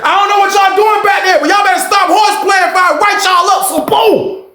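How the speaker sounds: very high, mock-scolding woman's shout; lady in the booth. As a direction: scold the inmates from the booth like a fed-up guard. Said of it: "The lady from the booth said".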